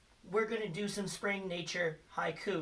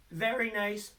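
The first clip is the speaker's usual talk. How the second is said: normal talking voice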